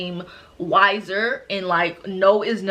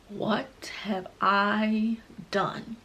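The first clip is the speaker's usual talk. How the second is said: slow, hushed melodramatic regret; mock dramatic; 8 dB quieter, much slower than usual. Telling of pain striking in the pool, mock dramatic. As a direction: draw out each word in theatrical dread. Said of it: "I'm being dramatic".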